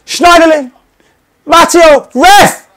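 high, loud shouts of names; excited yelling. Real, not acted. Gamer yelling at the screen in excitement.

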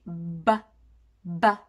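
バ held at closed lips, then burst out with breath; plosive B. Shown as a demonstration. Teacher demonstrating the B plosive, breath released with it. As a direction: pop バ low and hard from shut lips. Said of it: "口を閉じて息を吐き出すと同時に".

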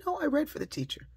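put-on high, quick little-girl reply; fast, high kiddie voice; child's voice. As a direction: imitate an eager small child. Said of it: "She said".

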